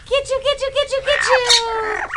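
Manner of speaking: sing-song high "get you" chase game; playful high teasing. Pretend. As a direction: tease the bird in a squeaky high voice. Teasing game with pet bird.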